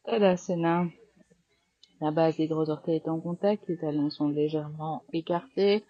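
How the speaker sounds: firm, clear cues over the breath; yoga class cueing. Acted. Yoga teacher guiding students through sun salutations, firm cues. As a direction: call out each pose and breath to the class.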